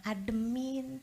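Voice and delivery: calm it down, fading out; soothing fade to silence; fading to a whisper. Shown as a demonstration. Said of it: "kalau ada yang lagi marah".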